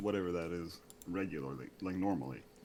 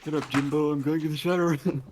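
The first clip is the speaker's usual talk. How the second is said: high, loud cartoon villain cry; anime villain voice; 9 semitones higher, 9 dB louder than usual. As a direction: shout it like a cartoon duelist. Yu-Gi-Oh anime quote impression.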